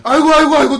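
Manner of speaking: high, loud fussing doctor; flustered doctor. Retold or imitated. Doctor who can't stand watching, imitated loud fussing. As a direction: fuss loudly as an exasperated doctor.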